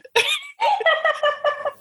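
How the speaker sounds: shrill helpless laughter, far above own pitch; high burst of laughter. Real, not acted. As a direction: break into squealing laughter. Cracking up at comment.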